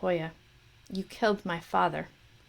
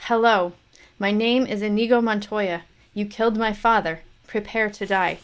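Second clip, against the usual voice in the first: avenging son shouting at his father's killer; strong challenge; 6 dB louder than usual. Acted. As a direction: declare it to the enemy. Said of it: "repeated challenge, growing".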